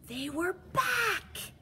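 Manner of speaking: high, loud, slow alarmed shout; shocked cry. A narrator's reading. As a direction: cry out in horror. Underwear returned, shock.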